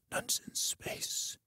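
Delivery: breathy whisper of the show name; whispered title. Shown as a demonstration. [name] whispers the title, slowed right down. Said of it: "Wow, it's worse".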